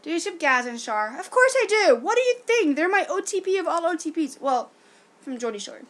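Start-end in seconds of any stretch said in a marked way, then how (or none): 1.2-4.4 high, loud, indignant gush; excited fangirling. Real, not acted. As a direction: burst out as if the answer were obvious. Gushing over favorite TV couple.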